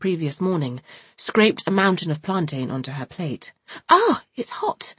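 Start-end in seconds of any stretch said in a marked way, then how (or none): 3.9-4.9 high, slow startled exclamation; surprised gasp. A narrator's reading. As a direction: react to hot food. Iry burns mouth.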